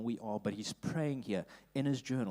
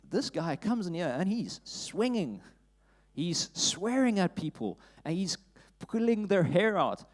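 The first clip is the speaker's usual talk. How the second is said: higher, louder, building excitement; excited; 7 semitones higher, 6 dB louder than usual. Real, not acted. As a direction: get worked up telling it. Preacher excitedly retells beating.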